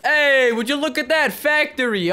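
loud, high, drawn-out "hey"; excited exclamation. Real, not acted. Gamer seeing the factory map load, excited exclamation. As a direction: call it out big and slow. Greets new map loading.

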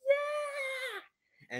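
high, loud cheer; cheering yeah. Retold or imitated. Kid recalls cheering at the reunion, high excited shout. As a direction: shout it like the crowd. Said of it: "and I'm like".

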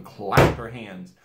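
usual speaking voice

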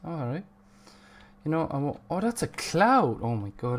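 ordinary delivery

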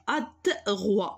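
ادغوا slowly sounded out in parts; ادغوا broken up, slow and deliberate; slow dictation. Shown as a demonstration. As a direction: draw out ادغوا as if writing it. Teacher sounds out word while writing.

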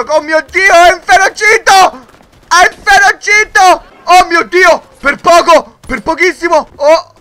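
high, loud terrified cries; terrified shouts. Real, not acted. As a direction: cry out in real fright. Rhino enraged, narrow escape. (grida terrorizzate, acute e forti)

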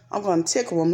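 ordinary talk, nothing marked

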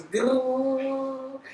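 slow, drawn-out growl of a generator; imitated generator rumble. Telling viewers about the kitchen noise, a slow growling rumble. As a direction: mimic the generator droning outside. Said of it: "all you can hear is".

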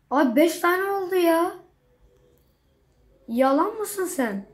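outraged at being cheated; indignant. Real, not acted. Para eksilince kızma. (öfkeli şaşkınlık)

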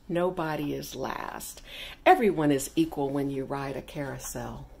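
ordinary delivery

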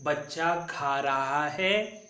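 slow and even, word by word; slow model pronunciation. Shown as a demonstration. Teacher repeats the sentence slowly for the class. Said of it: "फिर से एक बार उच्चारण करेंगे".

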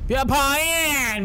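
sing-song بیا پایین drawn out high; high, slow coaxing call. Acted. Coaxing the character down.

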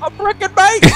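high, loud cartoon British accent; hammed-up British accent. Mocking the Brits to his friend in a silly British accent. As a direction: squeal it like a bad Brit. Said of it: "I apologize to our friends in the U K".

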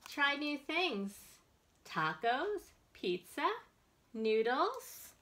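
bright, expressive picture-book reading; reading to children. Acted. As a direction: read it out to a circle of little kids. Librarian reads picture book aloud.

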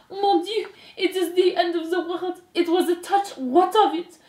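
high, theatrical French lament; melodramatic French mother. Acted. Despereaux's mother laments her son's confession, melodramatic French. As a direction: wail it high, as if all is lost.

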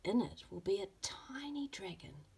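whispered secret about the tiny dragon; storybook whisper. Acted. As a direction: hush it like a magic secret. Mother shares a secret.

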